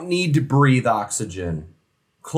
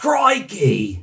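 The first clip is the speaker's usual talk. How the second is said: high, hammed-up Australian exclamation; mock Australian accent; 5 semitones higher than usual. Helping co-host with the snake catcher, mock Australian accent. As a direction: throw out a cartoon Australian cry. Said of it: "I can't do an Australian accent".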